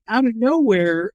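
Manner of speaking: ordinary talk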